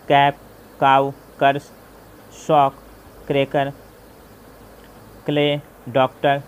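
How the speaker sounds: each example word said loud and separate. Shown as a demonstration. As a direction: call out कैप and the others like a teacher. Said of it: "जैसे cable". (तेज़ आवाज़ में उदाहरण शब्द)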